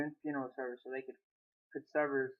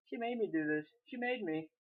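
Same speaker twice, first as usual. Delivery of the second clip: high, pleading outcry; crying out; 7 semitones higher, 5 dB louder than usual. A narrator's reading. Maria begging her husband to believe her, crying out. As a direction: plead desperately, starting loud then easing off. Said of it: "Maria cried out".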